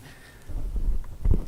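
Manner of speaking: short breathy scornful laugh; a huff of disbelieving laughter; bitter laugh. Real, not acted. Bitter laugh mid rant.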